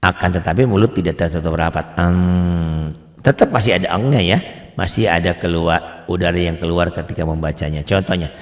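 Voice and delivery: ordinary talk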